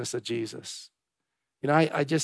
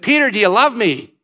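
louder and higher, insistent; Jesus' pleading question; 6 semitones higher, 8 dB louder than usual. Acted. Jesus asking Peter a third time, loud and insistent. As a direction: press the question home.

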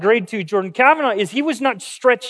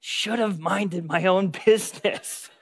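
rueful laugh; 2 semitones lower, 8 dB quieter than usual. Retold or imitated. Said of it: "I remember thinking".

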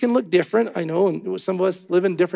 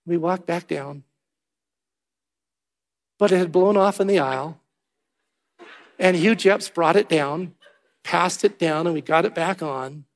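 ordinary delivery

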